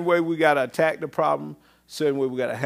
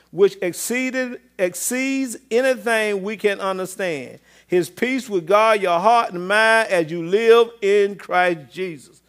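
raised, forceful preacher's Bible reading; scripture reading; 7 semitones higher, 8 dB louder than usual. Acted. Preacher reading Philippians to the congregation, raised and emphatic. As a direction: read scripture out strongly to the congregation.

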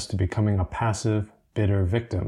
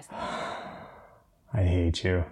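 mock exasperation; 2 semitones lower, 5 dB quieter, much slower than usual. Sighs at partner's pun.

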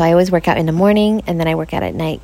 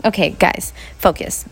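ordinary talk

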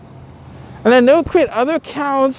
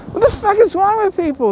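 exasperated high; 8 semitones higher than usual. Real, not acted. Repeats higher.

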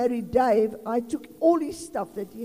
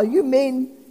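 ordinary delivery

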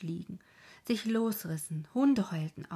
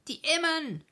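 höher und lauter herausgerufen; gerufen; 10 semitones higher, 5 dB louder than usual. A narrator's reading. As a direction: ruf es laut als Signal. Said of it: "rief er". (lauter Ruf des Obmanns)

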